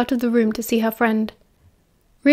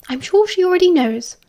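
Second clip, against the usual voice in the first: higher-pitched, no-nonsense reply; brisk reassurance in a raised pitch; 7 semitones higher than usual. A narrator's reading. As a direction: brush the worry aside efficiently. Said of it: "Lily replied briskly".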